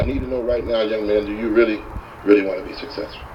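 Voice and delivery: low-pitched, intent and serious; grave, measured guru challenge; intent questioning. Retold or imitated. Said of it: "really intently".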